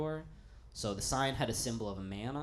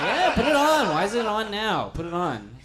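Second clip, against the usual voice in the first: much higher and louder, giddy with laughter; eager yes; 12 semitones higher, 15 dB louder than usual. Retold or imitated. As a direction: gush like a kid begging for the sequel. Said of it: "and it's like".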